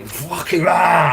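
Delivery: drawn-out, high, loud shout; slow furious yell; angry shout. Retold or imitated. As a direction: roar it out like a man losing it. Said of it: "And he was like that".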